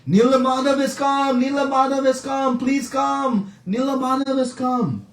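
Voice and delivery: high, sustained herald's call; announcing. Retold or imitated. Villagers announcing through the land, high crier's call. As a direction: proclaim it like a town crier. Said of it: "they went announcing".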